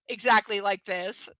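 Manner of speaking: ordinary talk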